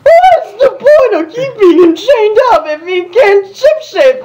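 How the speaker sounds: high, semi-feminine but manly voice; Rainbow Dash voice. A narrator's reading. Rainbow Dash asking Celestia, high half-feminine manly voice. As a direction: push it up high yet keep it manly. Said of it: "a slightly feminine one that was still very manly".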